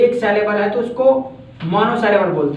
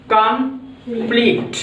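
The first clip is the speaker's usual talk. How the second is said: Complete slowly split as "कम - pleat"; broken into syllables; much slower than usual. Shown as a demonstration. Teacher showing two syllables in complete, broken into syllables. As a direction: say complete in two drawn-out beats, pausing before pleat. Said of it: "यानी दो बार में बोला है".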